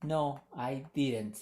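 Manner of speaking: "No, I didn't" drawn out slowly, "didn't" said as "didrent"; slow model. Shown as a demonstration. Teacher shows students the short form, slowly. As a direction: spell the contraction out slowly for learners. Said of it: "o lo pueden abreviar".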